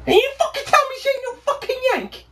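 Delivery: high-pitched, rapid challenge; incredulous rant, pitch soaring; indignant outburst. Acted. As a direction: fire it out in high, fast disbelief. Indignant challenge over her being Yank.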